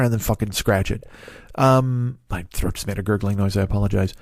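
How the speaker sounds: ordinary delivery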